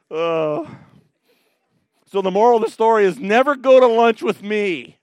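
ordinary talk, nothing marked

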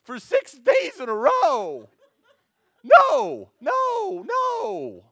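very high loud shouted no, no, no; exasperated outburst. Retold or imitated. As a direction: blow up at the seventh apology. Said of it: "Scott says".

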